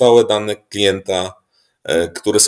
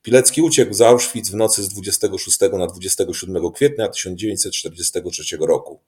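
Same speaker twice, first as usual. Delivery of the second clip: ordinary talk, nothing marked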